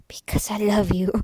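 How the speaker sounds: a hushed tender whisper; whispered confession. Acted. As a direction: whisper it like a secret.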